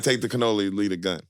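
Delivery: understated gangster quote; Godfather quote. Retold or imitated. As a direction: mutter it like a mob boss. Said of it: "Like, take, you know".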